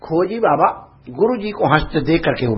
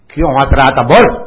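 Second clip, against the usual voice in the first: loud sharp interrogation; angry demand; 9 dB louder than usual. Retold or imitated. Guru demanding why the disciple laughed, loud and harsh. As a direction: demand an answer, hard.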